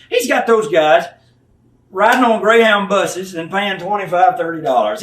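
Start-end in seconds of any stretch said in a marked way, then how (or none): none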